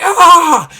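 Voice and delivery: high yelled charge cry; battle cry. Acted. Paladins charging the pirates, yelling. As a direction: scream into the charge. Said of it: "the Papal Paladin's like".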